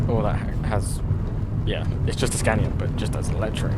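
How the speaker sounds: talking loud over road noise; speaking loudly. Real, not acted. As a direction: push the voice over rumbling gravel. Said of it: "I'm trying to speak as loud as I can".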